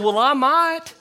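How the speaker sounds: high, loud, cocky comeback; defiant retort. Retold or imitated. Golfer talks back to the old man, loud cocky retort. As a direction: toss it back with a dare.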